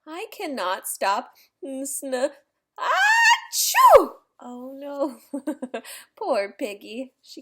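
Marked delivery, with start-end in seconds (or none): 2.8-4.1 loud, high explosive achoo; huge sneeze. Acted. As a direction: blast the sneeze out. Gerald sneezes again.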